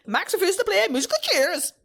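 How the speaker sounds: high, loud sing-song headline; announcer headline. Acted. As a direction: proclaim it high like a show segment title. Repeats the segment headline.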